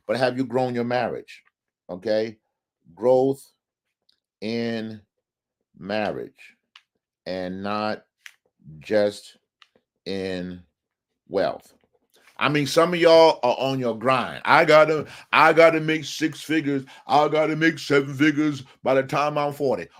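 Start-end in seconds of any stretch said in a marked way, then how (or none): 2.9-11.7 each word spaced out with long pauses; slow emphatic delivery, one word at a time; preacher spelling out Growth in marriage and not just in wealth. Real, not acted. 14.4-19.9 louder driven mock hustler; mimicking hustlers obsessed with six figures. Voicing grinding hustlers.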